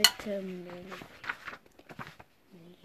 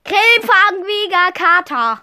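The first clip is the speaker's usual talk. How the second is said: loud, high, slow repeat for emphasis; 8 semitones higher, 13 dB louder, slower than usual. Real, not acted. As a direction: shout it out clearly a second time. Wiederholt den Namen lauter. (laut und langsam wiederholt)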